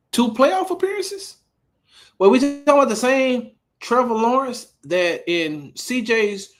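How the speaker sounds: high, loud, disbelieving questions; incredulous. Real, not acted. Host shows quarterback's stats on screen, incredulous. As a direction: pitch it way up and challenge the claim.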